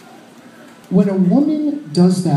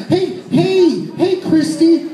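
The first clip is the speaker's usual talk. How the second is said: high, flustered stammer; panicked; 5 semitones higher than usual. Retold or imitated. Caught drunk woman explains the man to her friend, high and flustered. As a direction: babble excuses high and fast-thinking. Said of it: "her panicked look".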